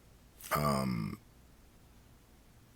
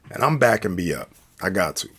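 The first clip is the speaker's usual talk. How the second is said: usual speaking voice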